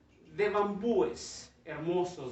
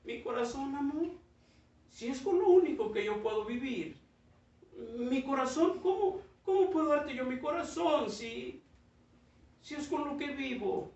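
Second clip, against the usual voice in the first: much higher, anxious protest with a nervous laugh; anguished bamboo; 7 semitones higher than usual. Acted. Bamboo anxiously refusing to give its heart, high anxious voice. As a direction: plead in disbelief.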